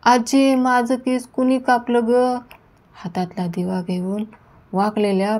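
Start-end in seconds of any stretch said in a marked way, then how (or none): 0.0-2.4 high, mildly shrieked alarm; a girl crying out in fright, higher. A narrator's reading. Girl shrieks to grandmother about her cut hair. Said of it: "ती किंचाळली".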